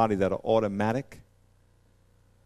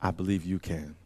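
softer, pleading leper; a leper begging Jesus, softer; humble leper plea; 6 semitones lower, 6 dB quieter than usual. Retold or imitated. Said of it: "This leper says".

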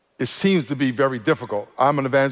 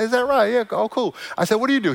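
much higher, surprised "Is that right?"; delighted reply; 8 semitones higher than usual. Retold or imitated. Pastor answering the stranger, much higher and delighted. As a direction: sound thrilled to hear it.

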